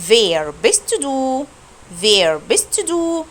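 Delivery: bist stretched very slowly; very slow drill read of bist; very slow German demo. Shown as a demonstration. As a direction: model "Wer bist du" word by word. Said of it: "السؤال التاني".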